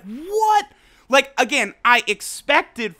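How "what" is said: high, startled what; a sudden jolt of shock. Retold or imitated. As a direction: blurt it out in disbelief. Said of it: "I was like".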